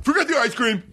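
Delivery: high, sharp give-up; fed-up guest cancelling the order; exasperated cancel. Retold or imitated. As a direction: call the whole thing off. Giving up on order.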